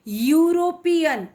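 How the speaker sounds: ordinary delivery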